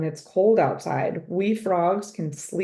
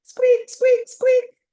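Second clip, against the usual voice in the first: much higher squeaky hamster squeaks; 7 dB louder, much slower than usual. Acted. The hamster squeaks at the frog next door, much higher. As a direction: squeak like a frantic little hamster. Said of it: "a long stream of squeaks".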